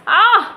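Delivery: a loud, high pained "aah" of frustration; pained sigh. Real, not acted. Homeowner venting to viewers, pained sharp cry. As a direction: let out the hurt in one sharp cry. Venting hurt over the house.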